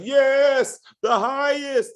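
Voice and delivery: high, loud worshipful gushing; mock adoring crowd chant; fawning follower. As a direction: hail the leader like a devoted fan. Followers fawning over leader.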